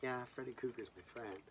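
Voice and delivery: put-on high, smug childish boast; proud kid boast. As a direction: brag like a kid showing off. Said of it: "And I'm like".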